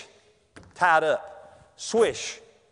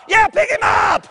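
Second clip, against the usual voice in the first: much higher and louder, slow yell; yelled from the pile; 12 semitones higher, 5 dB louder, slower than usual. Retold or imitated. Player buried under the pile, yelling loud and slow. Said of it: "I yelled loud as I could".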